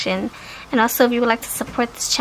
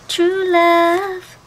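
swooning; 7 semitones higher, 3 dB louder than usual. Acted. Reading love messages.

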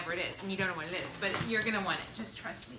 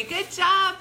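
cheering; 8 semitones higher, 8 dB louder than usual. Real, not acted. Cheering a viewer's comment.

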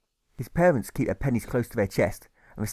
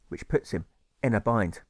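usual speaking voice

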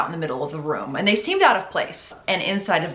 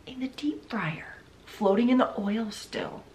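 hushed, breathy horror; soft, airy aside in disbelief; appalled whisper; 3 semitones higher, 7 dB quieter than usual. Real, not acted. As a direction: whisper the gruesome detail. Appalled hushed detail of the gruesome find.

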